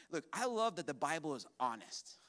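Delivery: normal talking voice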